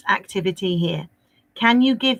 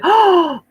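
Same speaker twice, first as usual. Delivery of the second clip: surprised gasp; 11 semitones higher, 6 dB louder than usual. Real, not acted. Teacher reacting to an answer.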